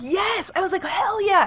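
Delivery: high, gleeful cheer; imitated victory shout; retold triumph. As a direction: celebrate the win. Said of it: "And I was like".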